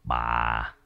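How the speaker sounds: resigned, speak low and quietly; subdued husband. A narrator's reading. Husband resigned to arranging prayers for his mother, low and quiet. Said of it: "đáp".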